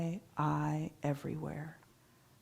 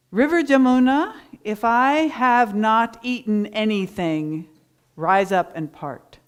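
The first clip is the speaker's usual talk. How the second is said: loud, high command to the river; commanding call; 4 semitones higher, 11 dB louder than usual. Retold or imitated. Sage commanding the river Jamuna to part, loud proclamation.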